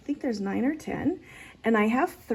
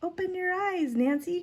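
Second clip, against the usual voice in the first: lightly acted cry; 9 semitones higher than usual. A narrator's reading. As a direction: read it out as a child's cry, high but gentle. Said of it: "cried Dorothy".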